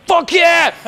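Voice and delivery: aggressive, high burst of hype; pumped-up cheer. Acted. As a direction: shout it like you're amped up. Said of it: "pumped up or aggressive".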